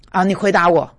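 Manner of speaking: usual speaking voice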